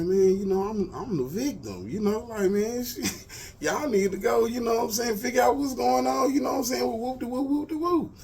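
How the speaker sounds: mock frantic protest, voice way up; hyped-up plea. Retelling how he begged the cops, high and worked up.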